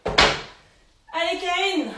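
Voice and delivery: « Allez » lancé fort, plus haut; cheering. Real, not acted. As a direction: appel motivant et sonore. Coach cheering a participant. (encouragement crié)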